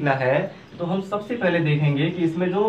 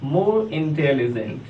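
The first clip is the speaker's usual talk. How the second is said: more intelligent drawn out very slowly; slow model phrase; 2 semitones lower, much slower than usual. Shown as a demonstration. Teacher demonstrating the comparative form, very slow. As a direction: say it slowly for students to copy. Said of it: "comparative form में लिखना है तो क्या हो जाएगा".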